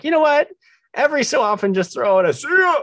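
high, loud mock-teacher voice; imagined ATC instructor. Host imagines an ATC school lesson, high mock-instructor voice. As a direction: pitch it way up as playful classroom advice. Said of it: "something you learn in A T C school that's like".

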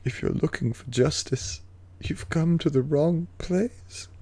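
soft, slow, drawn-out menace; Tyrion impression. Host quoting the trailer's last line, slow theatrical impression. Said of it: "Tyrion at the end, sort of goes".

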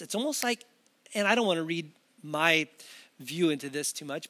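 ordinary talk, nothing marked

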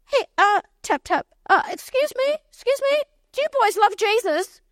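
high-pitched, excited butting in; acted-out eager "excuse me"; eager interruption. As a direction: pipe up high, trying to get their attention. Acts butting into their talk.

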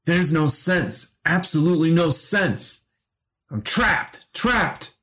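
put-on high, wailing old man in distress; distraught cries. A narrator's reading. Trapped older self cries out in great distress, distraught. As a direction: cry it out high, as one trapped. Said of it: "His distorted mouth finally vomited cries".

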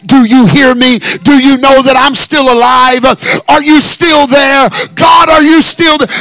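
high, loud cry of despair; retold scream to God. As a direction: scream it up at heaven. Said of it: "I have screamed to the top of my lungs".

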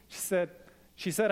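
ordinary talk

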